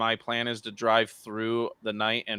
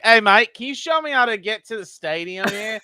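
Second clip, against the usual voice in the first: high, loud Aussie tourist; imitating Commander Jesse; 9 semitones higher, 5 dB louder than usual. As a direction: hail a stranger as a hammed-up Australian visitor. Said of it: "Commander Jesse just pulls up".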